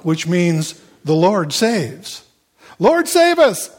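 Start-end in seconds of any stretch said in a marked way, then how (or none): 2.8-3.7 very high-pitched cry to God; acted urgent appeal for rescue; crowd's plea. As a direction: call it out high and pleading. Acting the crowd's cry to Jesus.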